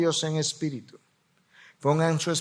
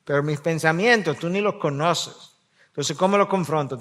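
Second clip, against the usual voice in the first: ordinary delivery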